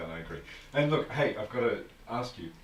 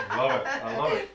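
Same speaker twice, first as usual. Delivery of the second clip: loud delighted burst through laughter; tickled, laughing exclamation; 11 semitones higher, 6 dB louder than usual. Real, not acted. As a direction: laugh out your approval, loud and lifted. Delighted laughing reaction to the drawn-out word.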